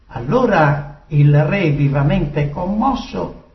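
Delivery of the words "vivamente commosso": ordinary delivery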